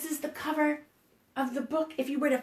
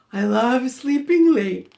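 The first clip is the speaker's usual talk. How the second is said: sleepy George; 7 dB louder than usual. Acted. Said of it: "said George".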